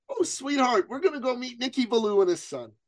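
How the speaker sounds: high, doting mom voice imitation; mother to child. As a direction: answer an excited child in a sing-song mom voice. A mother answering her little son.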